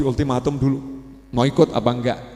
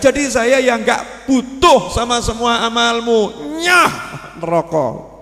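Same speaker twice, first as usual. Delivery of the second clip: higher, louder, slow rebuke; rebuking sermon; 9 semitones higher, 5 dB louder, slower than usual. Acted. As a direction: scold the arrogant worshipper from the pulpit. Preaching God's rebuke.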